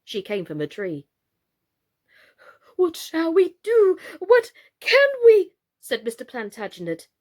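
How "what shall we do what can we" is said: very high, loud frantic cry; panicked doll. A narrator's reading. Mr Plantagenet crying out in frantic fright, very high and loud. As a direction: squeal it out in helpless fright.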